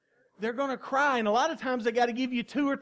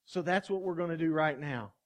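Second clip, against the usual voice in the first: ordinary talk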